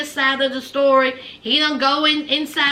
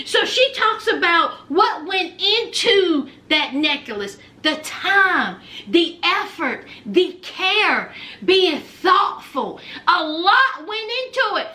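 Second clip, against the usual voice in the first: ordinary delivery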